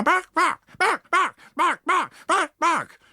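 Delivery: high, sharp little dog barks; yapping dogs. Acted. Pugs and Poms wanting to join the fray, yapping high barks. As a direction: yap like a tiny angry dog.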